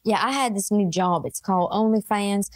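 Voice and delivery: lightly done Southern belle telling granddad; mock Southern belle. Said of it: "speaking like this".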